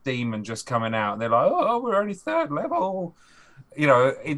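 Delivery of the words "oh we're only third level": high, panicked gamer whine; alarmed player. Retold or imitated. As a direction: squeak it out in sudden dread. Players facing a huge demon.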